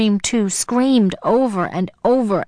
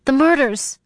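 high, distraught cry; frantic and pitched up; 6 semitones higher than usual. A narrator's reading. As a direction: cry it out, half out of your mind. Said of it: "I cried".

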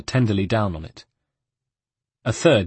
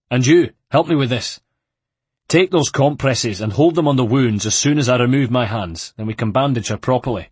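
brisk commanding; 3 semitones higher, 5 dB louder than usual. Acted. Antonio gives brisk instructions.

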